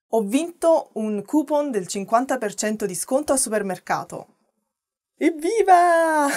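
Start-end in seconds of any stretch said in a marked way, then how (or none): 5.2-6.3 high, slow, drawn-out cheer of delight; joyful hooray stretched long; excited cheer. Acted. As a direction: celebrate the win out loud. Cheering her won coupon.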